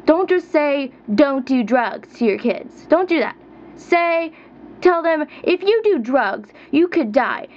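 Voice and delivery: ordinary talk, nothing marked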